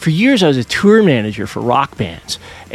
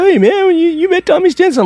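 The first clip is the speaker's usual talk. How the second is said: much higher, louder excited bro voice; imitating excited fans, much higher; mock eager question; 4 dB louder than usual. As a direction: pester the DJ with excitement. People keep asking about meeting.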